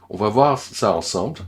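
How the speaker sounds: normal talking voice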